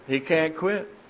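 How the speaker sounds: ordinary delivery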